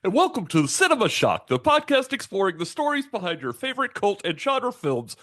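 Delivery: high lisping puppet host; bouncy puppet show intro, high; Muppet voice. Acted. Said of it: "Muppet voice has a lisp".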